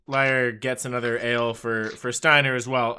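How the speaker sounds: ordinary talk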